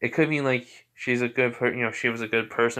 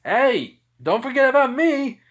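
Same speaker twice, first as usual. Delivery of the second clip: much higher pitched, louder playful call; imitating a boy calling for attention in a high voice; mock Zuko; 14 semitones higher, 6 dB louder than usual. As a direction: squeak it out like a kid tugging a sleeve. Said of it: "And Zuko's like".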